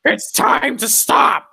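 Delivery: high, slow put-on yell; Filthy Frank catchphrase. Answering his friend's Filthy Frank bit with the catchphrase, high and drawn out. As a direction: stretch out the catchphrase in a cartoonish voice.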